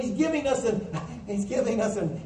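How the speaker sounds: ordinary talk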